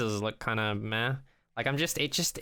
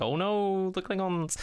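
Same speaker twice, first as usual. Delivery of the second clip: mock dread; 6 semitones higher than usual. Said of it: "I was like".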